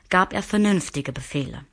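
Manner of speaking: usual speaking voice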